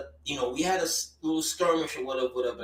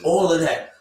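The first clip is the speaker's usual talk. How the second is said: ordinary delivery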